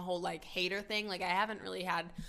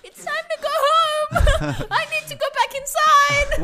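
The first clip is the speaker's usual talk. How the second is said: very high, loud, drawn-out mock wail; retold panic; 13 dB louder, slower than usual. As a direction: wail it like a comically scared kid. Retelling panic at the coyotes.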